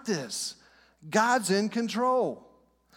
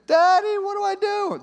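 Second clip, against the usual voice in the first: put-on much higher, louder little kid's plea; scared child; 11 semitones higher, 6 dB louder than usual. Child in a storm calling for daddy, high and scared. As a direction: squeak it out like a frightened child.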